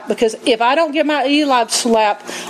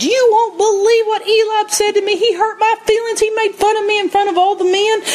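high, whining complaint to dad; mock tattling little brother; whiny tattletale; 9 semitones higher than usual. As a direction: whine it like a hurt little kid. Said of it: "he could have run all the way home back to his dad again and said".

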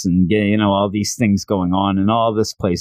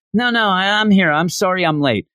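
much higher, frantic apology from a latecomer; breathless late girl; 8 semitones higher than usual. Retold or imitated. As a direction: burst in apologising like a flustered girl. Aries bursts in late.

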